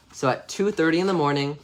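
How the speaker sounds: ordinary delivery, nothing marked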